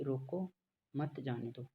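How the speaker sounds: रोको alone, clear pause, then मत जाने दो run together; pause after रोको. Shown as a demonstration. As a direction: break right after रोको, joining मत to जाने दो. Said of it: "रोको के बाद थोड़ा सा पॉज लेता हूँ".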